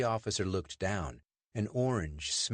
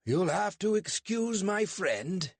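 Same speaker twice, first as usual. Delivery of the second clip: slow, higher British accent put on; David's put-on British accent, pitched high; 12 semitones higher, 4 dB louder, slower than usual. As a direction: fake a polite British accent to fool a constable. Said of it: "mustering up his best British accent".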